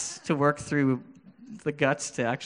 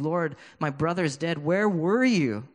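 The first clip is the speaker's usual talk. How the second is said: Mary bawling; 4 semitones higher than usual. Acted. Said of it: "she's bawling and she's crying".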